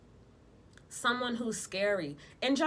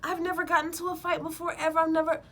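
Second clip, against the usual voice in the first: higher, hammed-up denial; mock protesting listener; 10 semitones higher than usual. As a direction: play an indignant skeptic, higher. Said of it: "you can be like".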